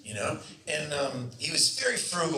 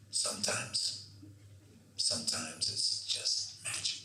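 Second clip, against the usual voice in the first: soft, barely voiced and low, slow; hushed awe; 13 semitones lower, 9 dB quieter, slower than usual. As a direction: breathe it out like a reverent director. Director's hushed awe after the take.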